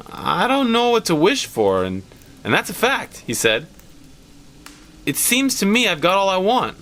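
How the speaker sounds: lightly voiced, unsure old man; hesitant old father. A hint of an uncertain old man.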